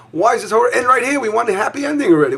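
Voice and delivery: impatient plea. Pretend. Voicing the listener's impatience.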